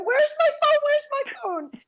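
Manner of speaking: high, frantic mock panic; hammed-up friend hunting for her phone; friend going crazy. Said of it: "she starts going crazy, going".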